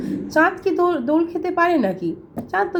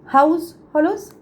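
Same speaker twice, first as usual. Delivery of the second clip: House and hollows said higher and louder, leaning hard on the h; h sounds stressed; 4 semitones higher, 3 dB louder than usual. Shown as a demonstration. Teacher showing the repeated h sound to students, with the h stressed. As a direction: punch the h at the start of house and of hollows. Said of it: "দুবার এইচ এর আসছে".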